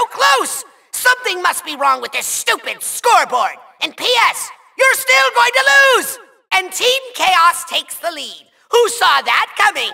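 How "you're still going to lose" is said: high, gloating villain yell; shouted taunt. Acted. Villain tells the Skylander they will lose, shouted taunt.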